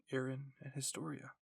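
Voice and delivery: ordinary delivery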